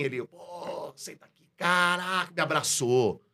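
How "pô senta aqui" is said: whispered, unvoiced senta aqui; hushed invitation. Old teacher hushes his student to sit, whispered. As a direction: breathe it out as a hushed aside.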